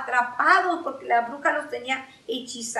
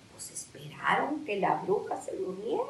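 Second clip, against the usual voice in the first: hushed, lower storytelling with a questioning rise; hushed suspense; 5 semitones lower, 7 dB quieter than usual. A narrator's reading. Storyteller waiting for the witch to fall asleep, hushed. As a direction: tell it low so the witch stays asleep.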